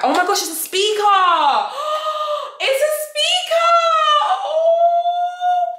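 high, loud gasp and squeal; excited squeal. Real, not acted. Vlogger discovering the gift is a speaker, squealing. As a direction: shriek with joy at the surprise.

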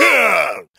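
loud growling roar; dinosaur roar. Acted. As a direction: roar like a T-rex. T-rex roars.

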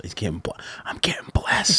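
half-whispered, soft; hushed awe. Real, not acted. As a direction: whisper it, thrilled to be gifted. Gift received, whispered into mic.

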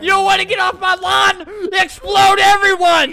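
high, loud screaming threat; mock rage, voice pushed high; hammed-up furious shout. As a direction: yell at trespassers at full volume. Threatening people on his lawn.

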